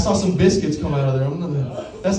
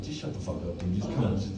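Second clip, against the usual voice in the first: inner irritation; 9 semitones lower, 9 dB quieter, faster than usual. Retold or imitated. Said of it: "I didn't say that. I was thinking that".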